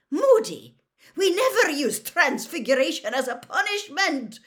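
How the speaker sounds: high, loud, scolding woman's voice; scandalised McGonagall. A narrator's reading. McGonagall objects to transfiguration as punishment, scandalised. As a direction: scold in outraged disbelief.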